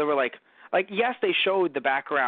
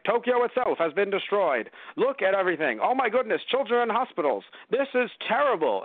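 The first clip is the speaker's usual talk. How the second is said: higher, hammed-up dismay over the ruins; mock dramatic lament; 6 semitones higher than usual. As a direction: overplay the tragedy with a pitch well up. Mock lament over first film.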